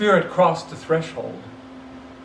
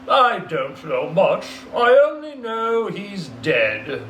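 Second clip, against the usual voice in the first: slow, louder, careless merchant voice; fat businessman, indifferent; 4 semitones higher, 4 dB louder, slower than usual. Acted. Businessman shrugging off the dead man, slow and careless. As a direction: drag it out like a bored rich man.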